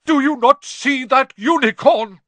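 high, loud centaur shout; full-voiced angry roar; furious bellow. A narrator's reading. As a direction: bellow the challenge at him. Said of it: "Firenze bellowed at Bane".

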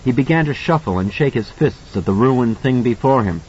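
plain reading voice

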